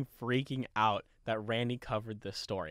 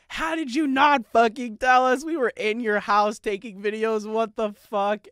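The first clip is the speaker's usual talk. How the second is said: much higher, louder outraged friend voice; mock outrage; 10 semitones higher, 7 dB louder than usual. Friends texting after finding out he was Randy, outraged. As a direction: squeal indignantly at being fooled.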